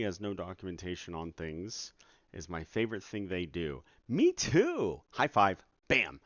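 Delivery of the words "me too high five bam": sarcastic cheer. Acted. Mock cheer at bad docs.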